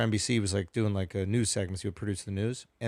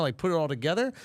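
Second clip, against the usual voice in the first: ordinary talk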